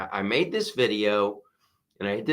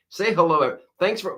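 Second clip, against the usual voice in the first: usual speaking voice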